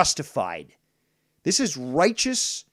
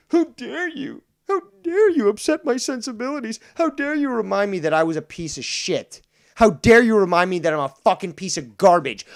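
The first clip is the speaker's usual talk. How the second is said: shrill-pitched mocking howl of offended pride, much higher and louder; mock outraged shriek; 6 semitones higher, 8 dB louder than usual. Mocking offended critics for being proven wrong, high shrieking outrage. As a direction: ham it up as a wounded snowflake, shrieking how dare you.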